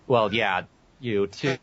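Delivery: ordinary delivery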